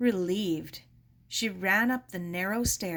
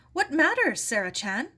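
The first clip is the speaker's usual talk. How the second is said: put-on old woman; 5 semitones higher, 5 dB louder than usual. Mrs Ito questions Sarah.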